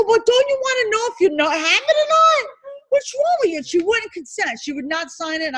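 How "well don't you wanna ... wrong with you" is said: high, exasperated, a little louder; exasperated scolding. Retold or imitated. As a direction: scold her, clearly fed up. Teacher retells scolding the client.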